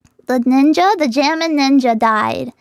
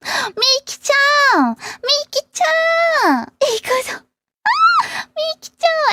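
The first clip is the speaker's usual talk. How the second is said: high, slow fan cheering; excited calling; 13 semitones higher, slower than usual. Pretend. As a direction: call out to her like a fan. Cheering for the idol character.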